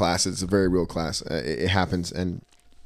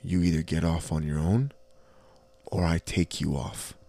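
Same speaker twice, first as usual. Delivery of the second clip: quiet menace; 3 semitones lower, 6 dB quieter than usual. Retold or imitated. Conductor threatening fare dodger, quiet menace. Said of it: "I was like".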